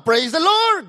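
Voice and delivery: high, loud joyful cry; praise shout. Acted. Pastor answers mockers with a high, loud praise.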